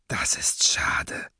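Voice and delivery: slow, breathy, near-whispered regret; cold masked villain. Acted. Masked leader regrets betrayal at gunpoint, slow and hushed. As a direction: say it slowly and very softly.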